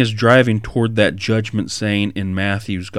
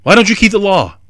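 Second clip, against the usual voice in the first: higher, louder, fast accusation; mock indignant accuser; accusing critics; 8 semitones higher, 5 dB louder, faster than usual. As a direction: fire it off like a scandalized critic. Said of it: "That's what they're gonna do".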